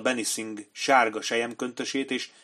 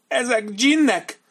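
much higher, slow, loud terrified cry; terrified commander; 13 semitones higher, 8 dB louder, slower than usual. A narrator's reading. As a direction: let the terror climb as he insists.

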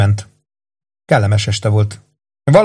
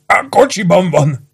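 high, strained, slow confession; tortured man giving in; blurting it out; 8 semitones higher, slower than usual. A narrator's reading. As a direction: force the words out in pain. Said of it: "bökte ki Rollo".

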